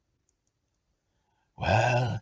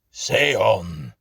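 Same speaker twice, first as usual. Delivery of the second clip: stern louder command; 2 semitones higher, 8 dB louder than usual. Acted. Ghost commanding the tenant sternly. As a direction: order it firmly. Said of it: "said the apparition sternly".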